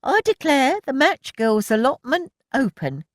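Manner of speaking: high, grand announcement; announcing. A narrator's reading. Milly grandly declaring the allotment open, high announcement. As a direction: proclaim it with mock dignity. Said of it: "she announced".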